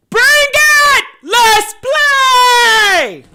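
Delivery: high, loud challenging shout; mock yelling. Reading [name]'s tweet aloud to viewers: hammed-up shout.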